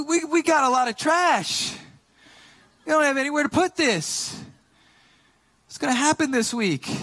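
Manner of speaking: high-pitched comic rant; fuming, retold. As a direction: complain in worked-up mock outrage about the trash. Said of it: "I'm, like, fuming".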